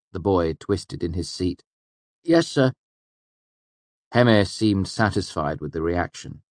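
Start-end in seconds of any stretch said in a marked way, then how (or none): 2.2-2.7 put-on higher, meek boy; cowed student yielding to the master. A narrator's reading. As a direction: mumble submission. Boy twists in his seat.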